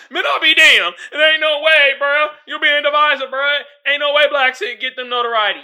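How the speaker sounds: loud, high mock protest; imitated indignation. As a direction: rant it as an outraged objector. Quoting indignant objectors.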